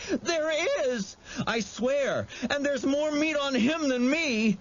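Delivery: high, crying insistence; desperate pleading. A narrator's reading. Terrified boy insisting to the monster, crying. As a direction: swear it through tears.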